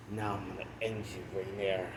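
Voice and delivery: hushed mock menace; retold quiet threat. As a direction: act out a threat very softly, understated. Retold threat from transformed hero.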